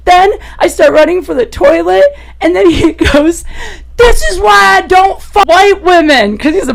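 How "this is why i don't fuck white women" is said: slow, loud, high put-on man's shout; exasperated outburst. Fed-up hookup shouting at the puking woman, slow and loud.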